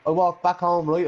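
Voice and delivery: ordinary talk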